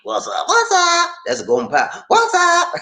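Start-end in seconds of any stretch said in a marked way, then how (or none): 0.0-1.1 high Gomer Pyle imitation. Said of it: "He said". 2.1-2.7 high goofy greeting; Gomer Pyle voice. Retold or imitated.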